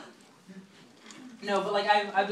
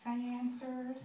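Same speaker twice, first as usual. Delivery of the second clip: high, soft put-on voice; small weepy squeak, hammed-up; mock tearful girl; 6 semitones higher, 8 dB quieter, much slower than usual. One-night stand admitting she took mom's call, mock tearful. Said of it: "pretty much my, my crying. And she's like".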